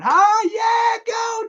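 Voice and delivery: high, loud cheering shout; Christopher Lloyd cheering. Retold or imitated. Film fan imitating an inmate cheering the chief on, high shouted cheer. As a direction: whoop it out at full volume, imitating wild cheers. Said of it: "Christopher Lloyd is just laughing, going".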